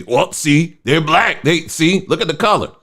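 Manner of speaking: high-pitched imitation of eager believers; mock excited claimant. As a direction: mimic someone excitedly pointing out proof. Mimicking people misreading a photo.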